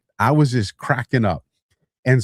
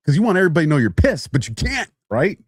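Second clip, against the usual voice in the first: normal talking voice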